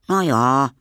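high, loud schoolboy voice; Paul. Acted. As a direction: answer as a young boy. Boy answers about school. (hohe, laute Jungenstimme)

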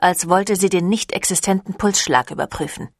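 plain reading voice

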